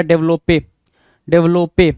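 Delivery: डेवलपे said with a sounded final e, "dev-lo-pay"; misspelled pronunciation. Shown as a demonstration. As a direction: add an extra "pay" syllable to finish डेवलपे. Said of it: "ये ई extra दे दिया".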